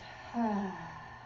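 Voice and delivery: long, soft airy sigh; breathy exhaled sigh. Shown as a demonstration. Guide models releasing breath while tapping, soft drawn-out sigh. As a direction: let the breath pour out and fade. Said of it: "letting out those sighs".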